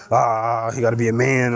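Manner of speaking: ordinary delivery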